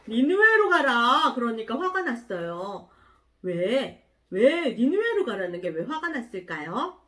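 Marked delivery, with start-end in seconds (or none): 0.1-1.3 God's command. Acted. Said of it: "하나님이".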